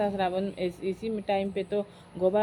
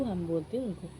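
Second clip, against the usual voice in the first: plain reading voice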